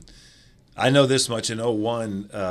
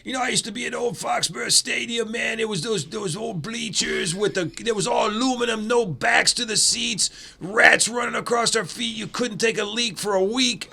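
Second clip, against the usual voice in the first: much higher, hammed-up voice of a grizzled old stadium regular; old-time local fan; 13 semitones higher than usual. Player retelling a fan's stories, higher hammed-up local voice. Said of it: "all the people that I got to hear their stories".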